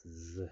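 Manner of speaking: "Z" as a bare buzzing zzuh; letter sound demo. Shown as a demonstration. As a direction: say "Z" as a sound, not the letter name. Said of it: "it's pronounced like".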